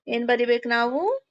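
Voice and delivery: normal talking voice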